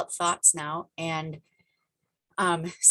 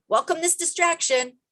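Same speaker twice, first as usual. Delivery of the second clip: mock quote; 11 semitones higher, 5 dB louder than usual. Mocking quote of Lisa's advice.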